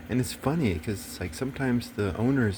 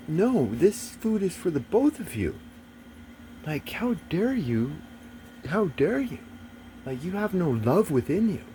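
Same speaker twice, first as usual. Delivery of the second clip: higher, scolding the dog in mock fury; a raised, indignant telling-off; retold outrage; 7 semitones higher than usual. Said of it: "because I got outraged".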